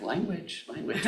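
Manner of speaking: mother scolding her son's swearing, mock and laughing; scolding mom. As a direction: tease like a mom catching a swear. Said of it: "and she's like".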